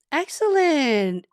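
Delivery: slow, high, loud stretched "Excellent"; a long sung-out "Excellent" of delight; drawn-out praise. Real, not acted. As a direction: savour each syllable of "Excellent". Praising viewer, word repeated.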